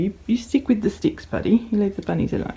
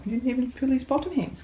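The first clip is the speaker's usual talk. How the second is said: ordinary talk, nothing marked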